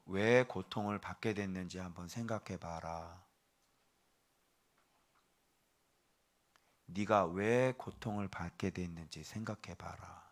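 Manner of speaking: gentle divine question. Acted. The Lord turning the question back on the sufferer, softly. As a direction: let him ponder it quietly. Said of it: "주님이 이렇게 물어보시는 거예요".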